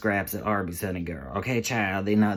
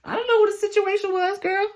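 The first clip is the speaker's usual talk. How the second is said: high, loud sassy put-on voice; high-pitched mock indignation; sassy disbelief; 5 dB louder than usual. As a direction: insist in a high girlfriend voice. Sassy aside with laughing start.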